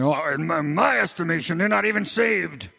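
mock self-righteous verdict, climbing in pitch; judgmental churchgoer. Preacher mimics someone judging another's salvation, self-righteous. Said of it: "and saying".

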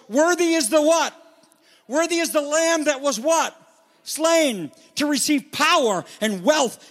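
high, loud, ringing proclamation. Acted. Preacher voicing angels praising the Lamb, loud voice. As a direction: cry it out like a host of angels, easing toward the end. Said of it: "were saying in a loud voice".

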